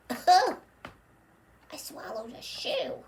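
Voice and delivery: high-starting puppet voice, fading; a hint of a silly old lady; lightly voiced old lady.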